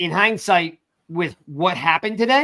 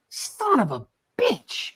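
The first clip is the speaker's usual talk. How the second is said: disgusted curse; 5 semitones higher, 5 dB quieter, slower than usual. Retold or imitated. Father reacting to the Eagles news, muttered curse. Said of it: "and I'm like".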